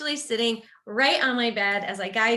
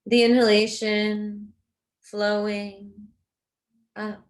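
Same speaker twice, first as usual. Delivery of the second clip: guided meditation. Real, not acted. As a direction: guide them gently into rest, unhurried and even.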